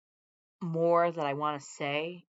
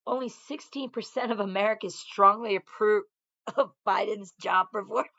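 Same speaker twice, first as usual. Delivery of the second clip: incredulous announcement; 5 semitones higher, 8 dB louder than usual. Real, not acted. Announcing a poll number, incredulous.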